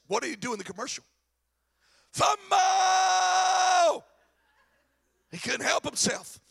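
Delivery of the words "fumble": big sudden shout; shouted fumble. Acted. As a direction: yell it like a sportscaster. Acting out the commercial shout.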